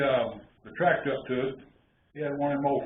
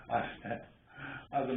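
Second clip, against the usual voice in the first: ordinary delivery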